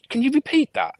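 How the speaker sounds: very high, squeaky disbelief; stunned high-pitched request. Retold or imitated. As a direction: ask in shocked disbelief. Retold disbelief.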